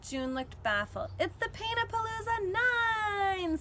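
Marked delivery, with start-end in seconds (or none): 1.2-3.6 proud. A narrator's reading. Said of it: "said Rosie proudly".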